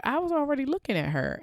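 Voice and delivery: high-pitched, quick admission; retold aside. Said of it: "I was like".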